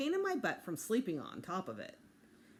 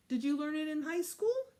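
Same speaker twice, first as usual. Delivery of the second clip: put-on curious child; 9 semitones higher, 4 dB louder than usual. Child asks Mom.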